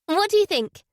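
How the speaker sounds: high, loud, eager question. Acted. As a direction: ask eagerly, hoping for praise. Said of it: "she asked eagerly".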